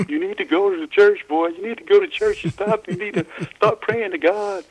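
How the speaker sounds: high, loud mock southern scolding; mother's southern accent. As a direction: imitate a southern mother scolding her boy. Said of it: "she said in a southern accent".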